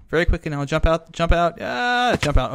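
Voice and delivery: panicked urgency. Real, not acted. Player escaping a zombie, panicked and loud. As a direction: urge it on loudly, rising to a yelp.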